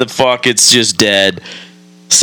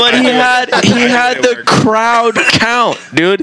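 much higher, animated retelling; excited storytelling; 11 semitones higher than usual. Real, not acted. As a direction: pitch it up with excitement. Excited retelling of crowd count.

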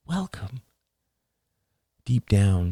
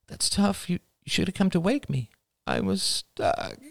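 higher, soft motherly voice; gentle mother; 12 semitones higher, 3 dB quieter than usual. A narrator's reading. Mother comforting her son, higher and soft. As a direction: soothe him, a bit rushed.

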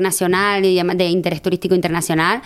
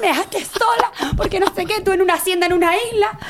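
mock furious friend, much higher; imitating an angry friend scolding her, pitched well up; hammed-up scolding from the angry friend; retold angry friend; 9 semitones higher than usual. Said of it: "con un cabreo".

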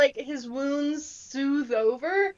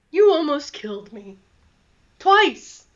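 indignant, louder on twice; 5 dB louder than usual. Acted. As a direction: hurl the last word at them. NPC accusing party.

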